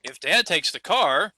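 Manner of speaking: slow, rising, hanging on car; hesitation rise on car. Shown as a demonstration. Teacher models a hanging clause for the class, rising car. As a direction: stretch slowly and leave car lifted. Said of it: "if I say".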